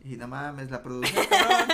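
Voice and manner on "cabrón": high, loud laughing exclamation; laughing outburst. Real, not acted. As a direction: burst out laughing on the word. Laughing outburst about the production.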